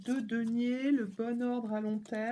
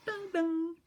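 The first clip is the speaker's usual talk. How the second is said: high ding ding chime; 7 semitones higher than usual. Acted. Chiming sound effect.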